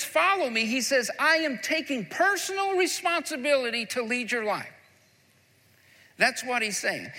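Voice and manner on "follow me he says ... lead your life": "Follow me" rings out high and loud, then the promise; preaching at full force. Acted. Preacher voicing Jesus to his church, loud and high. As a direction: lift the voice and proclaim it. Building sermon peak.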